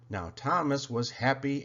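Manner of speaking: ordinary delivery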